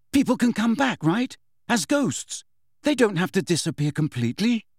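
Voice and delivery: high, eager questioning; urgent young character voice; insistent boy Harry. Acted. Voices Harry pressing Nick.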